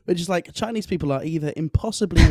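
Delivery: ordinary delivery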